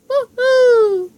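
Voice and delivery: high, loud cheer of triumph; joyful whoop. Acted. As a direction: whoop with delight. Celebrating freedom.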